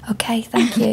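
hushed, polite little reply; imitated meek whisper. Client thanking the masseuse, imitated meek whisper.

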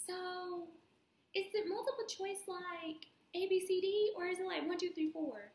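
high mocking classmate voice; high-pitched ditzy question. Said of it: "you're like".

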